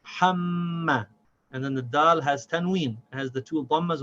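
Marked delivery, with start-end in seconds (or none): 0.0-1.1 HAMMA slow, m held into ma; unbroken mim. Shown as a demonstration. Teacher joining ham and ma, without stopping. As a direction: hum through the m of HAMMA. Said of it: "without stopping the sound of the mim".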